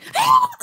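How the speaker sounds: short high-pitched scream; loud re-enacted scream; pillow scream. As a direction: let out a sudden, very high scream. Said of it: "I screamed in my pillow".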